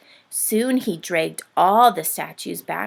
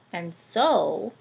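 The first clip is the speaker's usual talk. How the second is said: ordinary delivery, nothing marked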